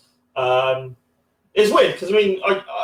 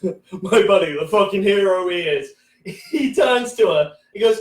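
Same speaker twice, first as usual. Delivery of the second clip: normal talking voice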